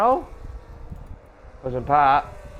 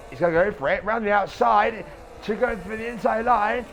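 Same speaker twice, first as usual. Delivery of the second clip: race caller; 8 semitones higher than usual. Real, not acted. Live race commentary.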